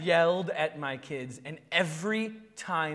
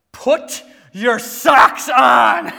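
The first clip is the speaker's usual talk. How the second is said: loud, slow, drawn-out parental order; exasperated dad raising his voice; rising anger; 6 semitones higher, 17 dB louder, much slower than usual. Retold or imitated. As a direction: stretch each word out, loudly losing patience. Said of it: "The anger's rising".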